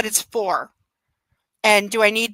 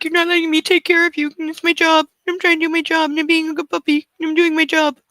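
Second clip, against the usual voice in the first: lightly voiced pouty puppy, a bit higher; voicing her dog; 6 semitones higher than usual. Owner voicing her dog feeling pushed away, lightly higher. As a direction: speak for the hurt dog.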